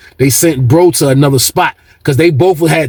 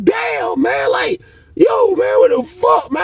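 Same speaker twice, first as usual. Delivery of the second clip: much higher, shouted disbelief; incredulous high shout; 4 dB quieter, slower than usual. Retold or imitated. Narrator hearing the news from cellmates: high incredulous shout. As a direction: yell it like you can't believe it. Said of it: "but I'm like".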